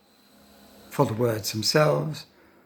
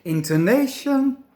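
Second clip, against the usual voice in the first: INTONATION with a raised, clearly drawn tune; demonstrated intonation; 8 semitones higher, 4 dB louder than usual. Shown as a demonstration. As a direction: sing out the melody of INTONATION a little. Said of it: "Listen to my pronunciation of intonation".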